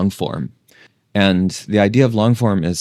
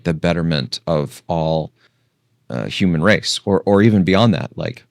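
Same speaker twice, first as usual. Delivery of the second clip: ordinary talk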